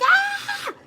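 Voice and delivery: short high scream, like a needle in the eye; a quick high retold shriek. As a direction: yelp high out of sudden pain. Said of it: "I was like".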